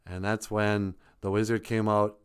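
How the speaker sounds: ordinary talk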